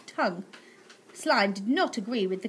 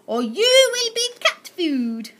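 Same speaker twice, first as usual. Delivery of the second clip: high, loud, slow threat; menacing aunt; 10 semitones higher, 7 dB louder, slower than usual. Acted. Aunt Greta threatening the boy, slow and shrill. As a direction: drag out the threat with glee.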